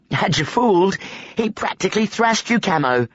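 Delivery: a novice mocking Camo after a trick; teasing boy. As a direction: crow over a fooled friend. Boys teasing each other.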